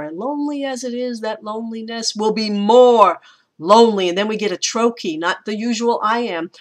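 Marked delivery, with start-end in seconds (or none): 0.0-4.1 quickened verse line building louder into "more"; fast, raised run-up, heavy stress on "more"; rushing to MORE. Shown as a demonstration. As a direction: hurry through the words and land hard on MORE. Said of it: "the line rushes to that more".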